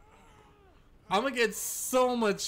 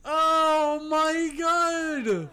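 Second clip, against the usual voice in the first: much higher, very slow and louder; triumphant; 9 semitones higher, 5 dB louder, much slower than usual. Real, not acted. Streamer celebrating his trap to chat, drawn-out glee. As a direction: stretch it out in glee.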